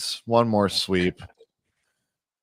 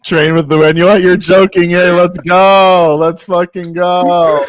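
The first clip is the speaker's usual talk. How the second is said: excited cheering; 9 semitones higher, 10 dB louder than usual. Real, not acted. Celebrating the race result.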